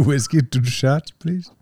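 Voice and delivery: high, slow mock Indian accent; drawn-out hammed-up order for whiskey; imitating Indian passenger. Friend echoing the Indian passengers' whiskey order, in a slow high mock accent. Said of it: "Every Indiano John was like".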